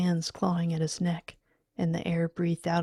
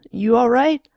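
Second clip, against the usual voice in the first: loud, high rising question; alarmed, raised query; worried calling; 5 semitones higher, 12 dB louder than usual. Acted. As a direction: shout to check on her.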